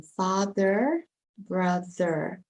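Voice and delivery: American "father, brother" with er endings; American pronunciation. Shown as a demonstration. Teacher showing what it would become, American er. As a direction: sound the final r in "father" and "brother", American style. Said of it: "In American, it would be er".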